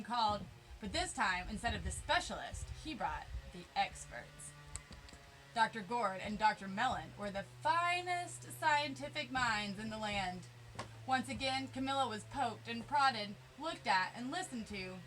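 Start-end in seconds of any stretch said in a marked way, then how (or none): none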